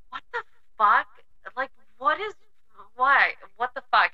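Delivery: high, loud what the fuck, retelling disbelief; incredulous outburst. Retold or imitated. Telling a friend how she reacted to the boss's apology, incredulous outburst. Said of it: "I was like".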